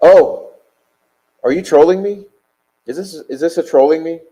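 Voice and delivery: sudden high, loud outraged questioning; indignant disbelief. Real, not acted. Streamer reacting to a viewer's insult with loud indignant disbelief. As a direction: caught off guard and getting heated.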